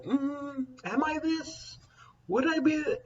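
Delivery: high, soft wondering to herself; musing. Real, not acted. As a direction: puzzle it out under your breath.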